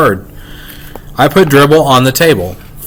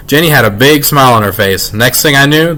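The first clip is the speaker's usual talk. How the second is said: plain reading voice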